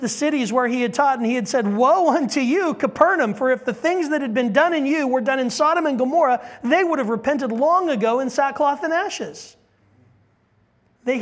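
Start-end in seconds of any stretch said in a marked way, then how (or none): 1.7-9.6 loud, high preaching cry; thundering woe. Acted. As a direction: cry out the woe over the city. Jesus pronouncing woe on cities.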